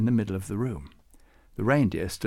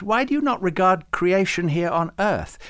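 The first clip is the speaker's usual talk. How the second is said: put-on high, fussy old saint; much higher, earnest old scholar voice; Farouk; 8 semitones higher, 4 dB louder than usual. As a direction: lecture him with high-pitched fuss. Farouk argues about sheep.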